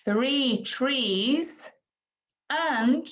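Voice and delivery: put-on slow, high sing-song counting for a small child; modelling language. Shown as a demonstration. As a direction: stretch each number with a swooping lilt. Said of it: "we might say something like".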